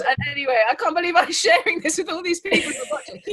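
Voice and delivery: high, laughing disbelief. Real, not acted. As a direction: laugh at your own blunder. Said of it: "how stupid I was".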